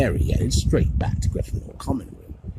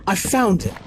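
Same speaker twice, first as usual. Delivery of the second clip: plain reading voice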